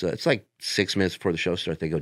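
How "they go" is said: ordinary talk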